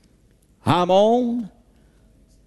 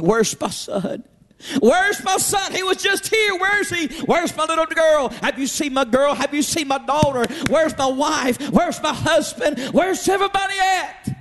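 high, mock-frantic calling, some shouts; screaming for family; 10 semitones higher than usual. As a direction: search desperately for lost family. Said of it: "weeping and screaming and crying".

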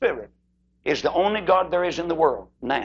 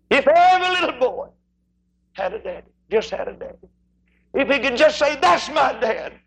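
high, ringing preacher's cry; Southern revival preaching swell; 10 semitones higher than usual. Real, not acted. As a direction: drive it home from the pulpit. Preaching at full force.

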